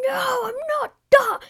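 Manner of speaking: mock indignant denial. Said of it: "I'm like".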